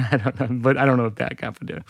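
normal talking voice

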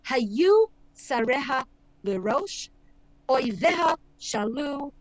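slow Hebrew verse with halting pauses; trailing, uneven recitation. Shown as a demonstration. Teacher demonstrating lament meter to listeners, slow and halting. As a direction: recite it slowly, pausing before each short ending. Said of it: "I don't know if I conveyed that properly".

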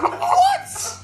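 very high, squeaked disbelief; high comic incredulity through laughter; mock shock. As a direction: yelp it in hammed-up astonishment. Acting shocked reply to dog line.

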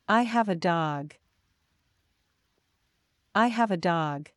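usual speaking voice

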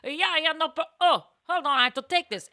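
lightly voiced busy Italian vendor; imitating Italian coffee seller. Retelling the coffee guy with a hint of Italian accent. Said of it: "a Looney Tunes version of an Italian guy".

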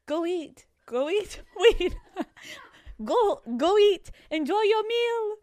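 high, badly faked British manager; attempted British accent. London manager sends guests to dinner, bad British accent. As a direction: fumble through a high-pitched mock British accent. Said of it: "What is this accent?".

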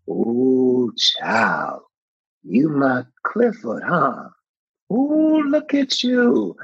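put-on a fond older lady, slow and high at the end; imitating Loretta. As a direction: savor every word like a doting grandmother. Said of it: "And she said".

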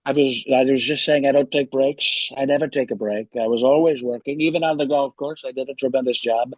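Trump impression voice; boastful rambling Trump voice; Donald Trump. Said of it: "was just doing the forty-fifth President of the United States, Donald Trump".

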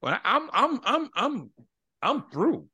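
stammering high, fed-up sputter; exasperated. Retold or imitated. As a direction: sputter like you've hit your limit. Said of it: "I'm irate".

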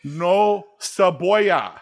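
slow, high, laboured Spanish order; halting dad voice. Retold or imitated. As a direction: imitate Dad labouring over each Spanish word. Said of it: "in this halting voice".

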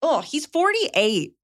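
high, slow, drawn-out disbelief; exasperated disbelief. Real, not acted. Host exasperated that the husband is a grown man, slow incredulous. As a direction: stretch it out in disbelief.

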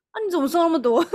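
high, loud startled question with a laugh in it; imitating a shocked friend, half laughing; surprised friend. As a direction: blurt it out like you can't believe your eyes. Friend reacts seeing her thinner.

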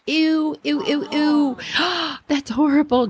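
high, squealing disgust; grossed out. Retold or imitated. Girls on the bank point at Julie, high grossed-out squeals. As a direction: squeal like you smelled something rotten. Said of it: "making gross faces like".

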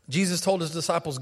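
ordinary talk

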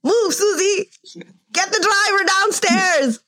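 high, crying pleading wife. Pretend. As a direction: beg him, almost sobbing. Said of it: "Susie's like".

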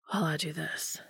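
Dean's soft teasing whisper. A narrator's reading. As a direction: whisper it like a promise. Dean teasing as he kneels.

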